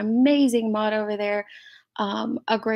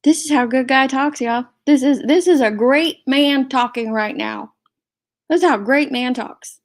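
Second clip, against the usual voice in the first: raised, louder voice dripping with scorn; loud sarcasm; 3 semitones higher, 6 dB louder than usual. Real, not acted. Host sneering at the ranting man's clip, loudly sarcastic. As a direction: hold it up loudly as a mock example.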